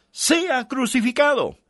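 high, slow outcry of the mob; crowd's cry. Retold or imitated. Preacher voicing the crowd demanding crucifixion, high slow cry.

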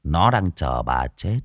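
put-on low, grim young man's warning; ominous warning. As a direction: warn her darkly.

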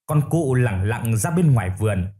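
plain reading voice

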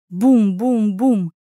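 slow, booming knocks; door knocking. A narrator's reading. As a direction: drum out three heavy knocks. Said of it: "колотить в дверь каблуком".

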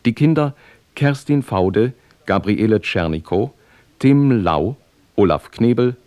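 usual speaking voice

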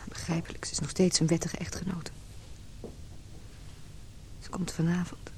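soft bitter whisper; hushed inner voice. Acted. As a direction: think it aloud under your breath. Inner monologue waiting at hospital.

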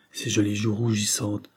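ordinary delivery

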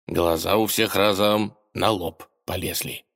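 plain reading voice

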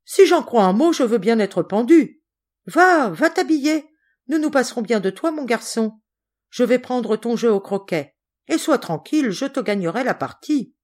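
high, lively, emphatic bossy relative; brisk bossy cousin, teasing. Acted. Cousin sending Georges off to dress, bossy and teasing. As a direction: shoo the boy off, high and brisk.

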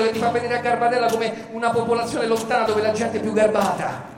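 ordinary talk, nothing marked

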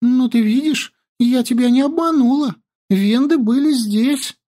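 high, quick, eager; eager girl voice. Acted. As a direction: rush it out high. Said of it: "быстро заговорила".

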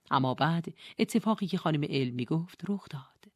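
ordinary delivery, nothing marked

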